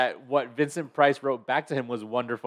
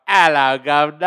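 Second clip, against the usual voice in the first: loud, brash British caricature; hammy British greeting, self-mocking; mock British accent; 8 dB louder than usual. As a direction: shout it in a terrible cockney-style British accent. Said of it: "get up there and go".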